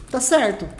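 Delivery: ordinary talk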